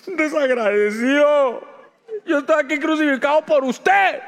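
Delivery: reproche indignado, fuerte y agudo; échale en cara lo que haces por él; reproche a gritos. Pretend. Reproche airado imaginado. (reproche indignado a gritos)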